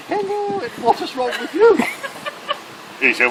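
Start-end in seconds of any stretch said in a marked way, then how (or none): 0.1-1.8 high-pitched mock-royal greeting; Princess Anne. Princess greets the sergeant in the next bed, high mock-royal voice. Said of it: "Goes up to the next bed".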